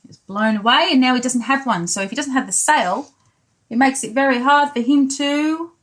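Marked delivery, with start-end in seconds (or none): none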